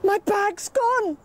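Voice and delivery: distressed cry. Acted. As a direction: realise the loss in panic. Woman discovers bag missing.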